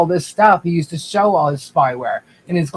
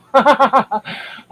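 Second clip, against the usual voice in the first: real laugh; 5 semitones higher than usual. Real, not acted. Laughing at the banter.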